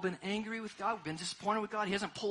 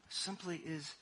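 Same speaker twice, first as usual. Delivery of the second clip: normal talking voice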